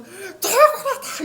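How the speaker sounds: high, slow, drawn-out cry of 돌고래다 with a laugh; excited shout. Acted. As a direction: call out the dolphin in delighted surprise. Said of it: "이렇게 소리치거든요".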